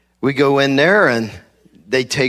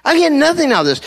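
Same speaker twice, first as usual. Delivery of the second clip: exasperated complaint. Retold or imitated. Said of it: "and I'm like".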